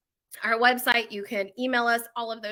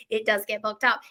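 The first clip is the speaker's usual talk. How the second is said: ordinary talk, nothing marked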